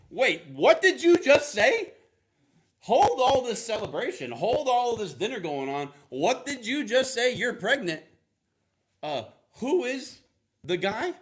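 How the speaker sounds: loud outburst. Retold or imitated. Joseph shouting at Mary over the pregnancy, loud disbelief. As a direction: blow up in disbelief. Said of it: "Like, to be loud".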